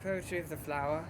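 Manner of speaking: soft, halting mock-poetic recitation; old-fashioned poetry recital. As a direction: recite it softly as improvised antique verse, with long pauses. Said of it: "old-fashioned poetry".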